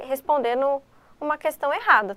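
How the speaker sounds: ordinary talk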